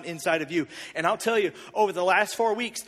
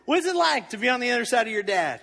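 nonchalant; 6 semitones higher, 6 dB louder than usual. Retold or imitated. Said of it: "I'm just nonchalant".

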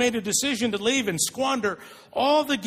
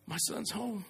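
tender whisper; 12 dB quieter than usual. Retold or imitated. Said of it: "He just".

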